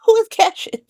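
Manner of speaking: high-pitched mock bewilderment; confused. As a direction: act out a baffled man in a high voice. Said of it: "He's confused. He's like".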